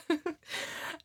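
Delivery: high, light laugh; real laugh at an accidental innuendo; soft high-pitched giggle; amused laugh. Real, not acted. Laughs at own double meaning.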